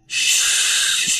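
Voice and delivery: whispered shush; hushing shh. Acted. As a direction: hush them softly. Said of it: "they said to each other".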